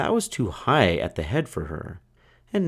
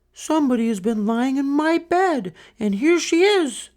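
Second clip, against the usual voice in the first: very high, louder at the end; little small wee bear voice; 3 dB louder than usual. Acted. Little bear finding Goldilocks in his bed, very high voice. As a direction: squeak it out like the tiniest cub. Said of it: "in his little, small, wee voice".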